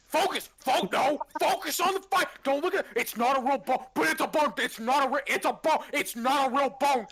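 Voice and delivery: high, loud frantic yelling; frantic shouting. Acted. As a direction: yell at the distracted head. GM voices creature shouting at Cerberus head.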